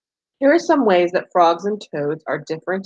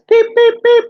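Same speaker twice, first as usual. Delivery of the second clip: much higher and louder peeps; spring peeper call; 8 dB louder than usual. Retold or imitated. Teacher reading to kids, peeping high and loud like a tiny frog. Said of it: "a spring peeper says".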